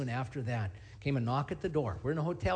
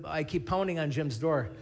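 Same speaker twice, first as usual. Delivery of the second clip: usual speaking voice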